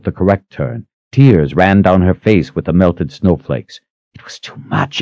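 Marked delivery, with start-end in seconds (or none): 4.1-5.0 breathy whisper of despair. Acted. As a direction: whisper it, overwhelmed. Despairing inner thought.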